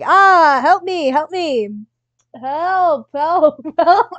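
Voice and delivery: loud, panicked calls for help; desperate cries for help. Acted. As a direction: cry out as if in danger.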